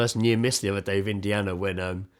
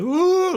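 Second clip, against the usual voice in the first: put-on high rising upset child ooh; upset child wail; 7 dB louder than usual. Child upset at their reactions, high wailing ooh. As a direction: wail like a little kid. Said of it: "she was like".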